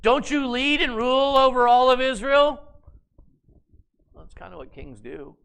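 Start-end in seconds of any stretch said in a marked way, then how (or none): 0.0-2.6 higher and louder, goading disbelief; indignant scolding. Acted. Jezebel goads her pouting husband in a loud, indignant tone. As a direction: shame him into acting.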